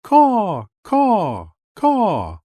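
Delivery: loud calls from high above; mother crow caws. A narrator's reading. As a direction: call down caw, caw, caw like a big crow. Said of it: "he heard".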